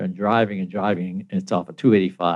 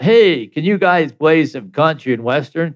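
mock yell, a little higher; retold yell; 5 semitones higher, 4 dB louder than usual. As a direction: imitate a bar customer calling out a request. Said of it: "and he yells out".

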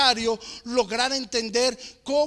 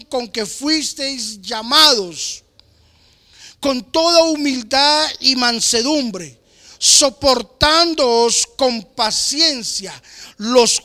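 slow, solemn Bible reading, a bit louder; scripture reading; 7 dB louder, slower than usual. Acted. Preacher reading Ephesians to his congregation, solemn and measured. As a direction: read the verses out with weight.

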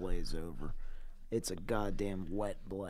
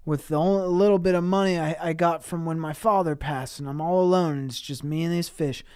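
loud, high pleading; mock begging clerk on his knees; 7 semitones higher, 12 dB louder than usual. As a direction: beg loudly for the shop, hammed up. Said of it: "He's begging".

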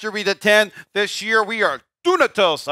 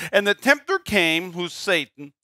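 usual speaking voice

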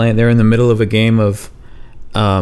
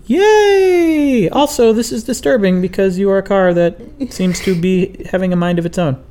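[name]'s high voice; 10 semitones higher than usual. Pretend.

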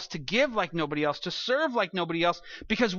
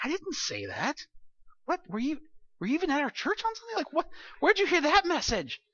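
much higher, baffled and exasperated; imitating his own disbelief, rising higher; incredulous inner reply; 9 semitones higher than usual. Pastor's unspoken reply to a confused congregant, incredulous and high. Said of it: "But I can't say that".

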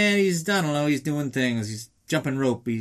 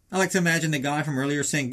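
ordinary talk

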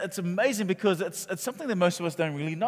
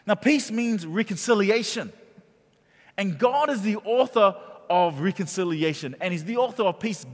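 louder, higher, pressing preacher's cadence; full-force preaching; 4 semitones higher, 5 dB louder than usual. Acted. Pastor preaching to the congregation, loud and fervent. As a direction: drive the sermon home to the congregation.

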